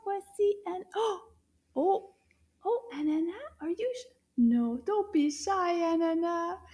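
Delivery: gentle coaxing to a shy friend. Acted. Coaxing shy puppet Anana.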